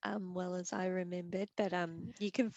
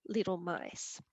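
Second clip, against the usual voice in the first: usual speaking voice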